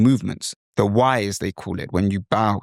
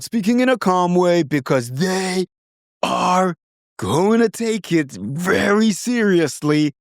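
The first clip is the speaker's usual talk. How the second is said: high, drawn-out wrestler promo voice; WWE conviction; 8 semitones higher, slower than usual. Acted. Imitating WWE wrestlers' way of speaking, forceful conviction. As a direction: stretch each word with wrestling-show conviction. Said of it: "the way that they'd speak is with a lot of conviction".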